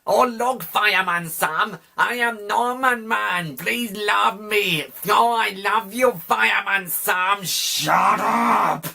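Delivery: mock the actor's annoying kid voice; mock Norman voice. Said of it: "trying hard to do an eight-year-old boy voice and a Welsh accent".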